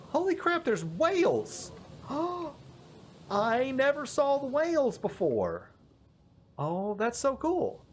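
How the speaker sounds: thrilled discovery of whales; amazed. Real, not acted.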